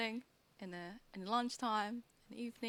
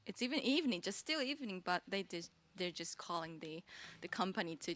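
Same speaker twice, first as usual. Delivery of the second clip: normal talking voice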